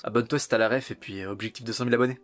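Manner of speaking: ordinary talk, nothing marked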